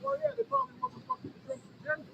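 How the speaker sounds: distant, faint phone voice; talking away from the mic; far from mic. Real, not acted. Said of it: "you sound far away".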